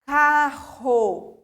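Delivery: "Carro" loud with a strong "rr". Shown as a demonstration. Teacher showing the digraph sound. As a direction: hit the double R hard and loud. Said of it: "dois R's juntos".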